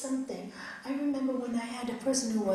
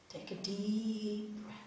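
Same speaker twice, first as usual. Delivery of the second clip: slow, softer guided breath; 7 dB quieter, slower than usual. Shown as a demonstration. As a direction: gently show how to breathe in. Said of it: "That's it".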